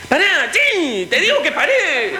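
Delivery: very high, strained-up yell for the bus; insistent shouted call. Acted. Unseen passenger to the bus driver, insistent high yell. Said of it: "siente que le gritan".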